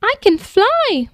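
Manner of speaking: amazed at himself; gasping with joy. A narrator's reading. The little dragon finding he can fly, gasping with delight. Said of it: "he gasped".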